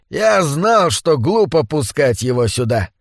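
ordinary delivery

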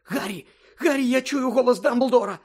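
high, loud, breathless alarm; breathless panic. A narrator's reading. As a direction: gasp out a frantic warning. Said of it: "ледве зводила подих Герміона". (задиханий панічний вигук)

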